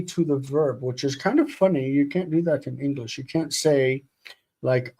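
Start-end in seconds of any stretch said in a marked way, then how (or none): none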